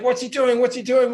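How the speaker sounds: puzzled crowd. Retold or imitated. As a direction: ask it like confused fans. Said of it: "Everyone's like".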